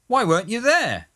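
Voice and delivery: high-pitched accusing question; reproachful bumpster. A narrator's reading. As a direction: demand a reason, voice pitched right up.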